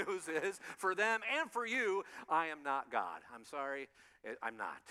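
usual speaking voice

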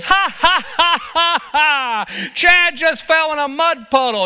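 mocking laugh. Friend laughing at Chad in the mud, high mocking laugh. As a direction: cackle at your friend in the mud. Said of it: "and he laughs".

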